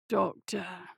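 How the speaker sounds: soft, raspy croak. A narrator's reading. Bound patient calling to his doctor, soft rasp. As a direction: rasp it out quietly. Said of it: "came a raspy voice".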